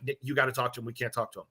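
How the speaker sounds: low, soft imitation of nervous buddies; hushed urging. Fan buddies urging Nick to talk, hushed and low. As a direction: drop the voice, confiding and urgent.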